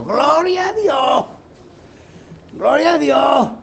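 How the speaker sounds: put-on high, slow old woman crying out praise; old woman shouting. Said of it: "salió gritando". (viejita gritando gloria a Dios con voz aguda)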